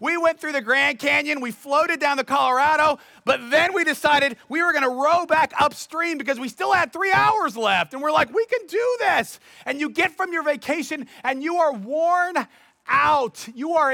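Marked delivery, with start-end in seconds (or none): 0.0-7.9 high whoops, then a keyed-up boast; excited outdoorsy vacationer. Retold or imitated. Preacher mimics outdoors people back from vacation, hammed-up excitement. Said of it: "Or the more outdoors people". 8.3-9.4 high-pitched, eager rallying cry; rally the group with mock enthusiasm; gung-ho. Preacher voices vacationers deciding to row upstream, gung-ho. Acted vacationers egging each other on.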